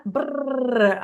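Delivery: rolled "brrr" trill; imitated rapid speech. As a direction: trill it like a rapid-fire blur. Imitates fast pace of Kerala speech.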